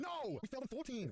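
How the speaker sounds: high-pitched wail of defeat; dismayed outcry. Real, not acted. Dismayed at losing the quiz.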